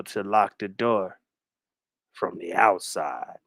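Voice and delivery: very low, slow, soft menace; slow, hushed low threat with long gaps; creepy stalker. Acted. Captor telling his guest she is locked in, low and creepy. [name] as stalker locking Brooke in.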